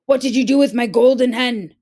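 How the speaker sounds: loud, fast giant bellow; giant calling. A narrator's reading. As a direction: bellow for his wife. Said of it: "Jack heard the giant call".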